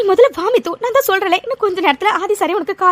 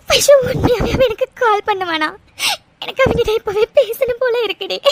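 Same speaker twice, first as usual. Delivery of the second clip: sobbing, needy question; tearful pleading. Acted. Mithu longs for his call, tearful pleading. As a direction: ask it while crying. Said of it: "இப்படி நீ அழுதுட்டு இருக்கிறத".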